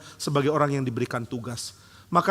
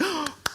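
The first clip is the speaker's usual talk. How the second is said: mock gasp; 14 semitones higher, 5 dB quieter than usual.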